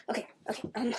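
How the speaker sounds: hushed unvoiced okay; whispering. Real, not acted. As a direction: drop to a whisper. Settling down, whispered.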